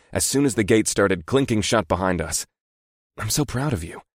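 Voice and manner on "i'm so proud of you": tender. Real, not acted. Tender praise to his women.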